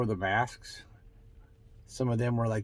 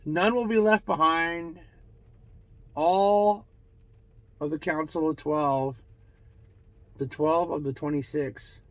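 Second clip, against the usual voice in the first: higher, declaiming voice with long pauses; channeled proclamation; 5 semitones higher, 4 dB louder than usual. Acted. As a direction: proclaim it for a council of spirits. Channels the council's pronouncement.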